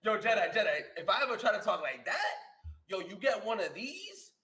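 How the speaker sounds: loud and high, laughing as he threatens; laughing mock yell of a strict father; mock parent threat. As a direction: ham up a strict parent's warning. Imitating strict parent warning.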